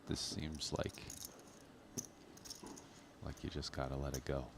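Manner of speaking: ordinary talk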